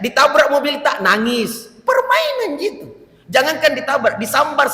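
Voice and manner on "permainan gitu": high mocking "Permainan gitu"; a light high-pitched mock; mocking squeal. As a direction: tease the crowd with a squeaky jab. Mocking punchline.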